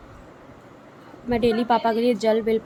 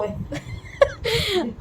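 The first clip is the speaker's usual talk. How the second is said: high laugh; 11 semitones higher than usual. Real, not acted. Laughing at aunt's visit.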